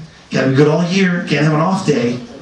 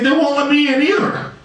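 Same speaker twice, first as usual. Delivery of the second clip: high-pitched indignant whine; acted aggrieved complaint; 11 semitones higher than usual. Santa locked out beside the soul, aggrieved complaint. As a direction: grumble high, as a fellow reject.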